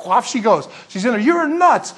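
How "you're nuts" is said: high, loud dismissive retort; scoffing disbelief. Acted. The others answer Rhoda at her news, loud scoffing. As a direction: dismiss her with a raised, incredulous voice. Acted disbelief inside the house.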